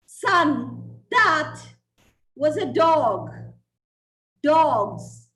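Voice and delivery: loud, urgent mother's scolding; a mother rat yelling at her son; shouted scolding. Acted. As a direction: drive the lesson home at full voice.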